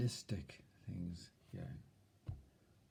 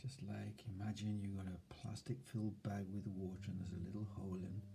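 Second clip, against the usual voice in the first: softer, hushed, half-whispered calm; soft soothing voice; 6 dB quieter than usual. Shown as a demonstration. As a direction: soothe yourself like a child. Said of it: "See that tone of voice?".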